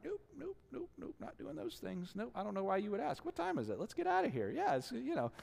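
high, hurried nervous denial; small high-pitched voice of someone hiding guilt; evasive denial. Acted. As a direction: squeak out nopes, dodging the question. Acting himself dodging the doctor's question.

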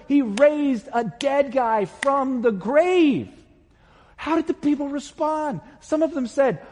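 fiery pulpit climax; preaching at full force. Acted. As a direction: lift the congregation with mounting fervour. Preacher builds to a peak.

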